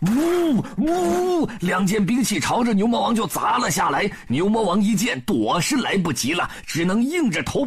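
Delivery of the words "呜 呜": two high imitated swooshes; double whoosh. A narrator's reading. Two weapons fall on Bull King, high whooshes. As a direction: voice two weapons cutting the air.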